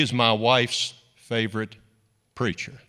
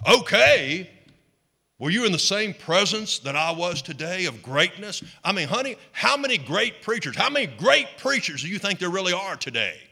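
affronted prideful preacher; 4 semitones higher than usual. Acted. Preacher to his wife after a lukewarm compliment, affronted. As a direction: act out a puffed-up preacher's wounded disbelief.

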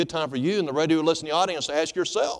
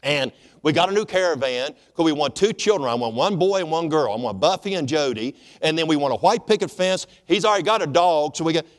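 excited bride; 5 semitones higher, 6 dB louder than usual. Retold or imitated. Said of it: "She goes".

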